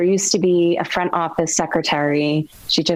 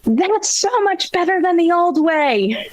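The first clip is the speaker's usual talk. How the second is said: put-on much higher-pitched kid voice; imitated schoolboy; 11 semitones higher than usual. Seventh grade boy praising the new grammar approach, imitated high-pitched voice. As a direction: call it out as a schoolboy would. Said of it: "a seventh grade boy stood up and said".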